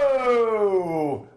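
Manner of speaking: high, drawn-out whoa sliding down; exaggerated descending whoooa; long whoa. Real, not acted. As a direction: let a whoa fall slowly from the top. Mock reaction to her answer.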